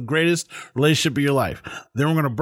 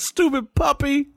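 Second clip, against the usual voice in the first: high, slow mocking voice; high whiny put-down of a puppy on TV; 11 semitones higher, slower than usual. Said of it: "I think".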